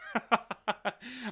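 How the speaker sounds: short, quick chuckle; a real little burst of laughter; sheepish laugh. Real, not acted. Apologizing for not being a fan, sheepish laugh. Admits disliking a unit.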